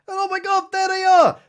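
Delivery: hammed-up horror as the robo-zombies arrive; mock alarm. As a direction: shriek it like the zombies just showed up. Zombies suddenly appear.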